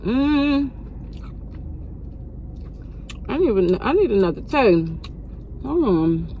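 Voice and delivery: muffled chewing between words; speaking while eating food; mouth full, chewing. Real, not acted. Seen 0: Bit chicken at -5; lips closed, jaw working, tender held throughout.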